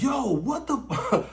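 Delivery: high, drawn out and cut off; losing it in disbelief; slow high yo, breaking off; stunned disbelief. Retold or imitated. Overwhelmed mid-act.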